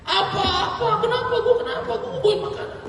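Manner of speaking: very high, laughing squeals; mock yelling. [name] being dragged away, shrieking through laughter very high. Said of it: "masih teriak-teriak".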